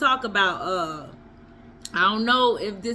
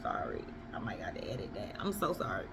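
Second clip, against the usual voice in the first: much softer, apology under the breath; sheepish aside; 14 dB quieter, faster than usual. Real, not acted. Apologizing to viewers for cursing, hushed aside. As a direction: drop the voice like you're caught out. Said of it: "I don't know if I can say that".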